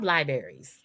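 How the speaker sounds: libraries as LIE-berries; berry mispronunciation. Shown as a demonstration. Cohost joking it is not a fruit, libraries as LIE-berries. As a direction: say libraries like a kind of berry, first R gone. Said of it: "That's not a fruit".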